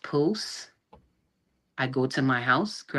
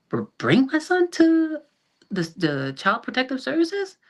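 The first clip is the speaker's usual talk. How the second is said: stammered, much higher incredulous question; retold disbelief; 12 semitones higher, 3 dB louder than usual. Mother questioning the order to bring her son to Child Protective Services, incredulous. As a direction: voice climbing high in retold disbelief.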